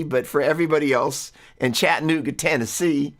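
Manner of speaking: a hint of a Southern accent on Chattanooga, Tennessee; lame Southern accent. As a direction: toss in a quick, half-hearted Southern accent. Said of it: "sorry, that was a lame accent".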